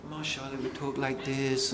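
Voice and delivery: low, hushed, gentle imitation; mock soft-spoken talk. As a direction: drop low and soft, imitating a mild preacher. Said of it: "you will think the Prophet would".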